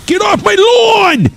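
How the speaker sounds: high, drawn-out mock yell. Homeowner to kids on the lawn, mock yelling. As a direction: yell it high and long, hamming it up. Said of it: "I'm gonna be yelling at kids".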